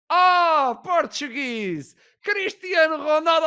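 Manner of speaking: loud, high exclamation of recognition; delighted guard. Retold or imitated. Guard sees a Portuguese passport, loudly delighted. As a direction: imitate a thrilled guard, stretching the nationality.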